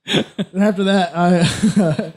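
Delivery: usual speaking voice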